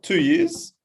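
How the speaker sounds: feigned casual. Retold or imitated. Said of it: "And I was just like".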